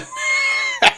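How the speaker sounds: shrieking laugh; high-pitched laugh. Real, not acted. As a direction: burst of squealing laughter. Reacting to Tuvix joke.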